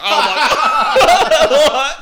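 slow, high words squeezed out through laughter; can barely get it out for laughing. Real, not acted. Laughing at own embarrassing story.

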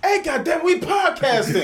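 high, loud holler through the wall; exasperated high-pitched shout; shouting at neighbors. Pretend.

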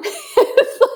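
bright burst of laughter; real laugh. Real, not acted. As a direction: laugh out loud at your own joke. Host laughs at her joke.

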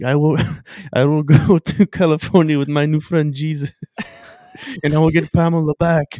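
high, loud laughing Borat impression; mock Borat. Quoting Borat at church.

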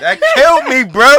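laughing in disbelief. Real, not acted. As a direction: crack up while saying it. Laughing at [name] news.

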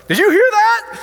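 put-on an old lady stunned by her own cracking leg; amazed exclamation. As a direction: blurt it out in disbelief. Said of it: "And she goes".

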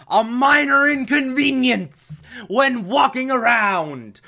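high slow gloating villain; cackling villain, drawn out; mad scientist. Acted. Voicing Penguin's gloating trap.

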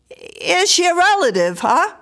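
loud, high-pitched neighbour's query; questioning man. A narrator's reading. Man questions the frightened woman.

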